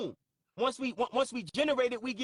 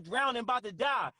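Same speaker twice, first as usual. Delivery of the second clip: normal talking voice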